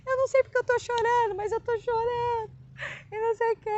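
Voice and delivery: high, quick, crying voice; retelling her own sobbing words to her husband; tearful, high voice. Retold or imitated. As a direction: squeeze it out through tears. Falava, "Eu não sei porque eu tô chorando.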